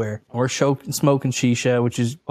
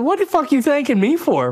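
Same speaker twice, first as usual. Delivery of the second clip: incredulous outburst; 12 semitones higher than usual. Retold or imitated. Retold disbelief at sheik's thanks.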